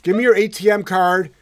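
loud, laughing mock robber; mock demand. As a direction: shake them down in jest, laughing. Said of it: "I'm going door to door".

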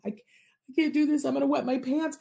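high-pitched overwhelmed protest; mock-hysterical pleading; hysterical, retold. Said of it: "I was crying and laughing and hysterical".

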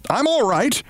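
high call to calm her; mock reassuring yell. A narrator's reading. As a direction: shout up that all is fine. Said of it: "Briggs yelled to reassure her".

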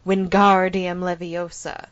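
"Wingardium" with GAR long and loud; slow "Wingardium Leviosa", heavy weight on GAR; stress on GAR. Shown as a demonstration. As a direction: punch and stretch the "gar" in "Wingardium". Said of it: "supposed to be the pronunciation of Gar".

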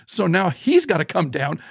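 ordinary talk

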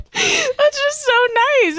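high, slow, crying voice; tearfully moved, words stretched out high; choked up with gratitude; teary. Real, not acted. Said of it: "a little teary right now".

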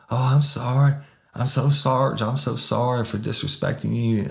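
high, gushing apology; groveling apology. Retold or imitated. Suspect apologizing to the sergeant, high and groveling. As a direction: gush sorry like you mean it.

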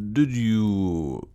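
drawn-out, deliberate question; slowly. A narrator's reading. Sanderson questioning Clayton about the passes, slowly. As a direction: stretch each word out. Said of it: "said Sanderson slowly".

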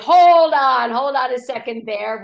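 mock startled interruption; hold on, mock alarm. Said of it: "Your face and your tone are not matching the words".

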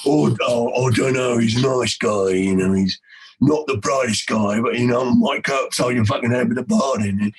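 deep, slurred lowlife mumble. Retold or imitated. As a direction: play a dim, menacing dude low in the throat. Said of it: "your version would be like".

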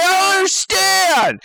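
high-pitched mock bewilderment; hammed-up confusion; baffled passenger. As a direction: imitate a clueless man in a loud, high voice.

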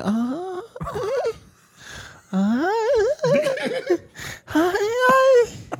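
soft, spaced-out little moans; demonstrated whispery moans. Shown as a demonstration. Woman showing how she whispers instead of screaming, soft demonstrated moans. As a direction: sigh out small high "ay" sounds, softly. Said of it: "yo susurro. Como que".

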